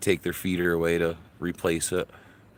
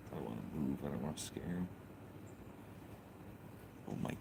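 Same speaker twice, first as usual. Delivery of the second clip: much softer, sliding into a whisper on "Oh my God"; hushed; 5 semitones lower, 15 dB quieter, much faster than usual. Real, not acted. Streamer hushes near a hummingbird so as not to scare it. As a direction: keep it down so the bird stays.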